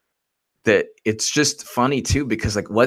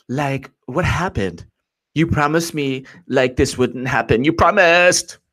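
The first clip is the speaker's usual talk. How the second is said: drawn-out mock Valley girl complaint; 4 semitones higher, slower than usual. FBI employee feeling let down, Valley girl. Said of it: "Valley girls from, from the Valley".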